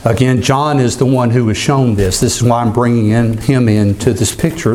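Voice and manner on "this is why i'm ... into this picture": ordinary delivery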